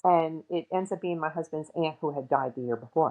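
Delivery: ordinary delivery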